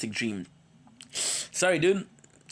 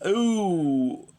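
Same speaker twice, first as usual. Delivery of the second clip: high, drawn-out "ooh" sliding down; long ooh; 6 semitones higher, 4 dB louder than usual. Real, not acted. Streamer realising a task is due now, long ooh. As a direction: let out a loud falling ooh. Spontaneous exclamation before next task.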